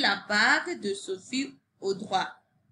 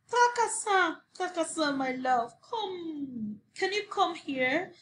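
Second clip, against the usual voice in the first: high sing-song call; woman calling the little man; 6 semitones higher than usual. A narrator's reading. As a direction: call him from far away. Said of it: "Shouted the woman".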